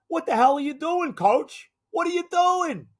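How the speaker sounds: high, loud exasperated yell; angry player confronting coach. Retold or imitated. Receiver yelling at the coach at halftime, angry. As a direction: get in the coach's face, demanding answers. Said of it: "gets in his face at halftime".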